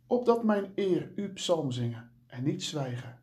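measured, reverent reading of a psalm; solemn scripture reading. Acted. Devotional host reading the psalm to listeners, reverent. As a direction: read it like liturgy, unhurried and devout.

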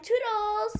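high, drawn-out cutesy sign-off; playful goodbye. Real, not acted. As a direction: stretch the goodbye out sweetly.

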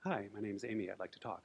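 hesitant scared caller, voice dropping away; small scared voice. Retold or imitated. Amy calling a suicide hotline, timid and quiet. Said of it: "scared voice comes on the phone".

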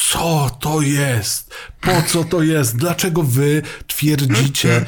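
very slow, drawn-out questioning; acted bewilderment. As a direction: stretch each question out in mock disbelief. Acted outraged question at motorcycle arrival.

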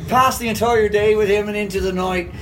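enthusiastic, rambling Irish brogue; playful imitation of a chatty Irishman; Irish accent. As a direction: sing a friend's praises in an Irish accent. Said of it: "It's a beautiful accent".

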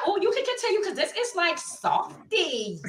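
high, rushed gush slowing on softy; delighted retelling. Retold or imitated. Client cooing to the waxer, delighted. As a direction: gush it high, then draw out the last words.

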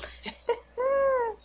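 high-pitched sob of relief; a squealed, emotional cry breaking out; choked-up high whimper; tearful cry. Real, not acted. Overcome telling son survived.

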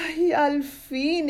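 high, slow, long sigh of relief. Acted. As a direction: sigh it out high, free at last. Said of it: "el garabato suspiró". (suspiro agudo y lento de alivio)

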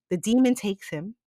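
ordinary talk

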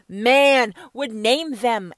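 ordinary talk

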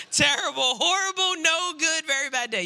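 milk the book title for drama; hammed-up storybook build; dramatic book title. Quoting the children's book title.

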